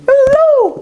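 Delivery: high, drawn-out greeting call; high-pitched party holler; calling out hello. Acted. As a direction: sing out a long hello to someone across the party. Waving and greeting a girl.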